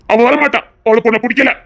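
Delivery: ordinary talk, nothing marked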